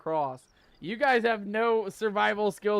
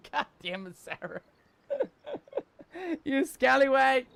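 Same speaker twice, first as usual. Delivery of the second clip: laughing scold; 3 semitones higher than usual. Real, not acted. Laughing at Sarah's prank.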